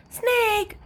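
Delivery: put-on high child exclamation; excited little sister. A narrator's reading. Little sister shakes dice, lands on snake, excited. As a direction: squeal the word out.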